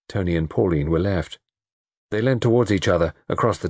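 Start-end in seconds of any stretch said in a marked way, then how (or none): none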